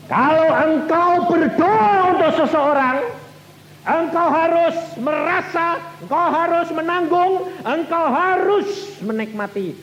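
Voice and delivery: high, loud fervent exhortation; full-force preaching. Acted. Preacher urging the congregation how to pray, loud and high. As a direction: drive it home to the hall.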